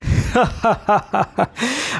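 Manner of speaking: light rising laugh; a quick amused laugh; short laugh. A narrator's reading. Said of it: "আমি হেসে বললুম".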